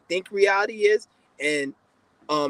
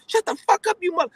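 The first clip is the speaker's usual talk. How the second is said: mock furious cussing; 13 semitones higher than usual. Man mocks the woman who cussed him out, high angry mimic. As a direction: screech her insult in a high mocking voice. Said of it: "the way she was cussing me out".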